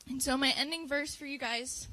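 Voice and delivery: ordinary talk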